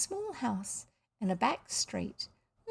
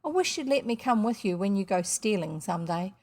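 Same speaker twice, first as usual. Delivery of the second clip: ordinary delivery, nothing marked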